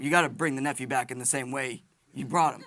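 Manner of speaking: ordinary talk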